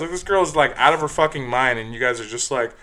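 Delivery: loud, high, furious scolding; angry rant. Retold or imitated. Bouncer yelling at creepy guys, loud and furious. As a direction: tear into them.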